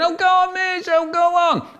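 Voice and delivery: high whiny begging; child pleading with the teacher. Retold or imitated. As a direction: wheedle the teacher.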